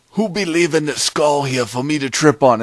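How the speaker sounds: high, loud cartoon complaint; indignant warrior. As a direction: holler in mock outrage as the tripped warrior. Voices warrior tripping on skull.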